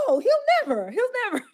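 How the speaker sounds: high, loud laughing no; high laughing disbelief from everybody; laughing denial. Retold or imitated.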